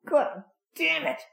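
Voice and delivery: a cartoonish high whine of frustration; whiny cursing mechanic. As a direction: imitate a neighbour yelling at his car as if tortured. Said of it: "he had sort of a high, whiny voice".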